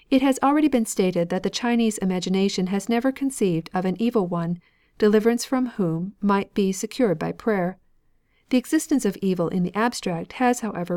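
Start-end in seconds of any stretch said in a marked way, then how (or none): none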